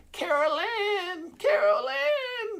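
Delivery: slow, high wailing call; Poltergeist girl. Retold or imitated. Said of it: "Remember Poltergeist".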